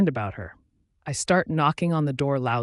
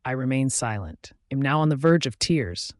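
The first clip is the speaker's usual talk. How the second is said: ordinary delivery, nothing marked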